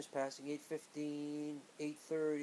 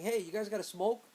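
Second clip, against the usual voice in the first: louder, higher, chummy question; cheeky Dwayne; 5 semitones higher, 5 dB louder than usual. Retold or imitated. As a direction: ask the cops brightly, as if among pals. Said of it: "Dwayne started laughing with the cop".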